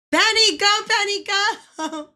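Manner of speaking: loud, high cheer for Penny; excited cheering. Real, not acted. Cheering the character on, loud and high. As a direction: yell her on, thrilled.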